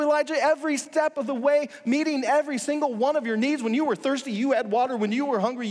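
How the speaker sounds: a parent listing everything they gave; impassioned pleading. Acted. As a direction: pour out the hurt of a provider ignored. God pleads with Elijah, building.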